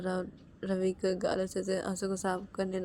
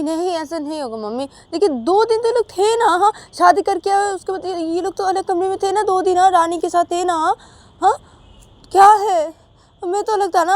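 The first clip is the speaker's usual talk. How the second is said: high, loud, insistent refusal; loud objection; 11 semitones higher, 18 dB louder than usual. Acted. Vikas refusing his mother loudly, heated and high. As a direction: shout him down, pitched high.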